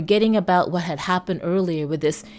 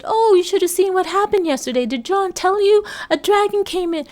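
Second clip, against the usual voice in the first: excited wife; 13 semitones higher, 4 dB louder than usual. Retold or imitated. Wife excitedly telling the news.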